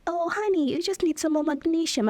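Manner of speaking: patronising doctor, sweetly; doctor soothing a patient, lightly voiced; doctor's sweet voice. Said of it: "she's like".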